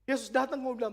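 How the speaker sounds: ordinary talk, nothing marked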